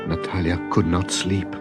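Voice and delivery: ordinary delivery, nothing marked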